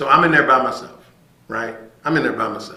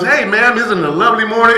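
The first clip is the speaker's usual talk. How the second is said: cheerful sheriff greeting; 8 semitones higher, 8 dB louder than usual. Retold or imitated. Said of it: "he goes".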